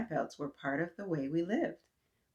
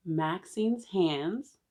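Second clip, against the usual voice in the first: drawn-out, deliberate title; slow title read; much slower than usual. Shown as a demonstration. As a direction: stretch the title out. Reading cover title slowly.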